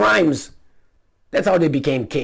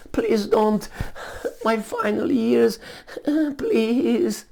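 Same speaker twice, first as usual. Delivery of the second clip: high, whiny begging, drawn out; mock pleading king; 9 semitones higher, much slower than usual. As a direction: whimper like a feeble king.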